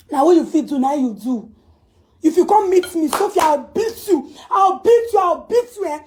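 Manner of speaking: loud, high yelled threats; furious shout. Real, not acted. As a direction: scream the threat at her.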